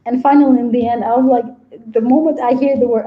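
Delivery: ordinary talk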